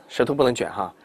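ordinary talk, nothing marked

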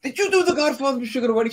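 high, fast excited question; excited recognition. Retold or imitated. Actor spotting his old castmate at an audition, excited recognition. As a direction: blurt it out on suddenly recognizing someone.

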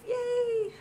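high squealed yay; little squeal of joy; excited cheer. Real, not acted. Cheering about the Lego set.

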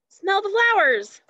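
smell the flowers said high and bright, sounds unswapped; excited reveal. Shown as a demonstration. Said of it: "What do you think?".

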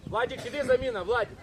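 loud summons from the sideline; calling out. Real, not acted. Coach calling Владик off for substitution, calling out. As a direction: call the player off, loud.

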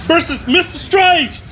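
loud, high hype announcer. Acted. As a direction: shout it like a fight announcer. Announcing the challenge matchup.

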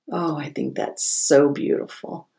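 ordinary delivery

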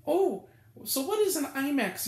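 hammed-up innocent voice; mocking woman. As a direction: ask like a clueless first-timer.

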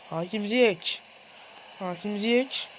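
ordinary talk, nothing marked

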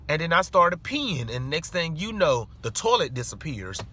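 ordinary talk

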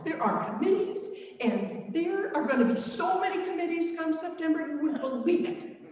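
eager disciples gushing about committees; excited disciples. Acted. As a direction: pile the plans on, giddy and unstoppable. Disciples' excited rush of plans.

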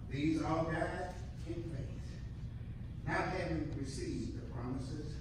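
normal talking voice

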